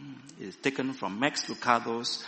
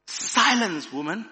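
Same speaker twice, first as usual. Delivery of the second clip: high, loud rebuke; angry cry; 9 semitones higher, 8 dB louder than usual. A narrator's reading. Cave dwellers rebuking the warmed woman, angry cry. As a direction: cry out a sharp command. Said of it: "cried the cave dwellers".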